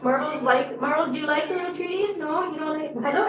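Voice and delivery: usual speaking voice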